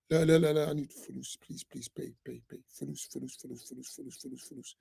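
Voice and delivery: a pitiful mock plea dying down to a whisper; whiny beggar. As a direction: beg weakly for money until the voice almost vanishes. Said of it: "That's what I hear all the time".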